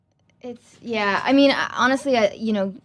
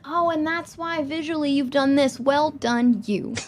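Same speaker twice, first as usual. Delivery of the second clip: fawning flatterer; 6 semitones higher than usual. Retold or imitated. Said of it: "be like".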